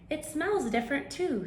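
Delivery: high chirpy bird voice. Acted. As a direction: sing it out as a little bird.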